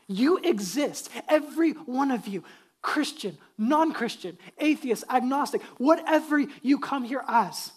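loud, emphatic sermon in punched phrases; fervent preaching. Real, not acted. Pastor addressing every one present, fervent emphatic preaching. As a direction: hammer each phrase out to the whole room.

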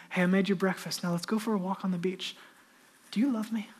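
soft, gentle invitation; Jesus gently inviting, ending in a whisper; tender Jesus. Acted. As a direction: speak it like a quiet kindness.

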